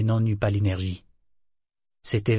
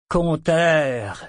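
loud, higher cry of the name; shouted discovery; 5 semitones higher, 5 dB louder than usual. A narrator's reading. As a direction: call the name out in sudden recognition. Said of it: "s'écria-t-il".

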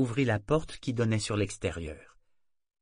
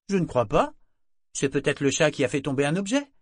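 put-on higher teen shrugging reply; girlish voice; 5 semitones higher, 5 dB louder than usual. A narrator's reading. Daughter shrugging off her mother's question. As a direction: toss it off lightly. Girl answers.

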